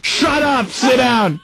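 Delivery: high-pitched mock yell; dad yelling. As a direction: imitate a father yelling at his kid. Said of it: "that was my dad yelling at me".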